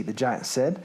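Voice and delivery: ordinary delivery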